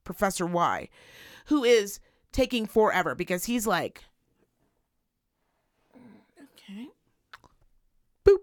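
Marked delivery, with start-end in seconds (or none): 5.9-8.4 whispered mutter to himself; hushed mock customer grumble. Imitating slow customer muttering.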